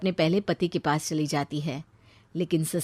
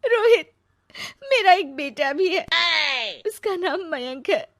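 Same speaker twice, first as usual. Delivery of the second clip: higher, tearful pleading confession; 8 semitones higher than usual. Acted. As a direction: confess through rising tears. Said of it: "वो रोने लगती है".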